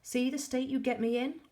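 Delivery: high, loud snap; angry yell. A narrator's reading. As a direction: yell it in fury. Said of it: "he yelled".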